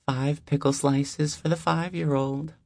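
high, friendly deli-lady voice; warm older neighbor. Acted. Deli owner welcoming the new neighbor, high and friendly. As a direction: greet him like an old friend at the door.

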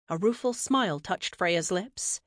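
ordinary delivery, nothing marked

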